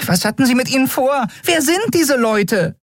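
high, rushed anxious questions; a tiny fairy spiraling into panic; hysterical. A narrator's reading. Nelen buzzes round Keru's head, firing panicked questions high. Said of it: "Nelen umschwirrte hysterisch".

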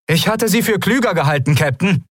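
high call across the hangar. A narrator's reading. Endriel calls out to the captain, high and challenging. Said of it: "als sie rief".

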